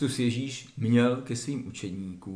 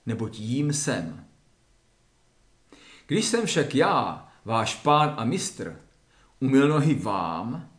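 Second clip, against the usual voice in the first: louder, measured Bible reading with solemn weight; scripture reading; 5 dB louder than usual. Acted. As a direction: read the Gospel to the listeners as a solemn text. Reads Gospel passage aloud. (slavnostní čtení evangelia)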